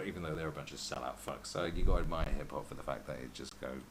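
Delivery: usual speaking voice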